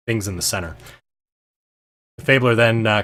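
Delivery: usual speaking voice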